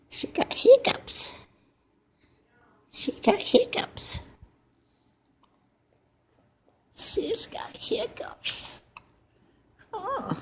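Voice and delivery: high soft whispered coo. Real, not acted. Grandma baby-talking to newborn.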